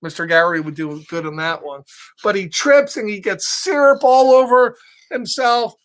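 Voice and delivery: plain reading voice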